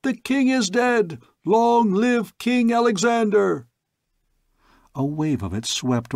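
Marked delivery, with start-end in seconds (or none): 0.0-3.7 much higher, louder chant of acclaim; crowd tumult. Acted. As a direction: roar his name like a crowd. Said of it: "A tumult interrupted him".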